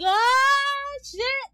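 high, loud mock scream; imitated ride scream. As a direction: yell it like dad on a ride. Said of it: "he's on a ride".

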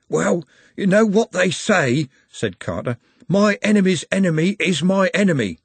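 Carter voice. Acted.

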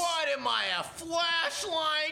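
high-pitched, drawn-out outraged holler; indignant yell. Acted. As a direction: yell it way up high, stretching the words. Acted outburst over reading in dark.

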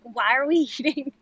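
put-on exasperated New York accent. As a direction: complain it like a New Yorker. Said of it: "your New York accent comes out".

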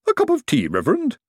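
high, sweet voice of a plump lady; lady's voice. A narrator's reading. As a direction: lift the voice high for the tea lady. Plump lady offers tea.

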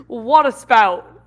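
slow, loud Cajun "Water spout"; Cajun accent. Retold or imitated. Slow hammed-up Cajun accent. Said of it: "my impression of a Cajun person saying water".

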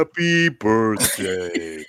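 slow, droopy deadpan comic voice; Jackie Vernon impression. Quoting Frosty the Snowman for his friends, slow deadpan. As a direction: drag it out like a tired hangdog comedian. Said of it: "my Jackie Vernon impression".

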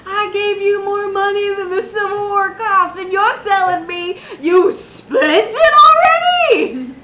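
high, loud wailing tirade; hammed-up scandalized yelling, near singsong; mock outrage. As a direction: shriek in disbelief, drawing out the words. Acted outburst over spent money.

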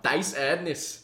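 slow, high mock shout in German; retold shout. Retelling shouting around Germany, drawn-out mock shout. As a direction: call it out long and slow. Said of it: "I would just shout".

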